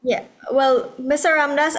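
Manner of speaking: ordinary talk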